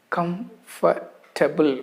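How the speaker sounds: comfortable in three syllables, KUMF-tuh-bul; comfortable slowly, stress on first syllable, R silent; correct pronunciation. Shown as a demonstration. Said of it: "What's the correct way".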